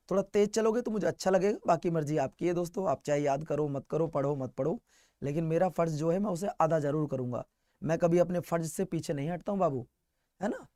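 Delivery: low, soft, earnest aside; subdued appeal. Real, not acted. Teacher telling students he will still do his duty, low and subdued. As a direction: drop into a quiet, resigned-sounding register.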